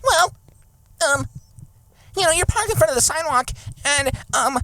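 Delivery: high prissy busybody voice, hurried; high mock neighbor voice. Friend mocks the complaining neighbor in a high fussy voice.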